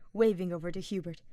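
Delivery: usual speaking voice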